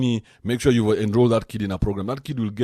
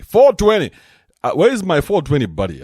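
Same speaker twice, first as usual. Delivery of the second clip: hyped shout; 7 semitones higher, 10 dB louder than usual. Real, not acted. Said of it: "This is four twenty".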